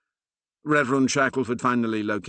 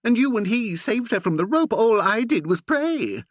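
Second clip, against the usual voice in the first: upset outburst; 5 dB louder than usual. A narrator's reading. Curate's guilty outburst.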